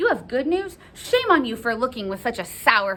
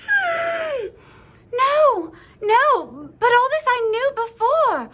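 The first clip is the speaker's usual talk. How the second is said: high, petulant whine of impatience; frustrated outburst; 5 dB louder, slower than usual. Acted. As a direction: protest like a thwarted Juliet. Juliet frustrated by nurse's stalling.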